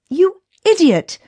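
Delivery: mild mock outrage at a friend; exasperated cry. A narrator's reading. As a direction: scold a reckless friend. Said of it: "cried Davy".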